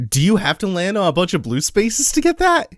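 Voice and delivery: usual speaking voice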